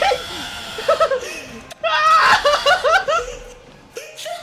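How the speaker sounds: shrieking burst of laughter; loud high laugh. Real, not acted. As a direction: howl with laughter. Big laugh at game moment.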